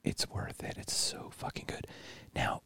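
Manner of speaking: hushed, breathless whisper; worried whisper. Real, not acted. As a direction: whisper it like a guilty secret. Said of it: "Why are we whispering?".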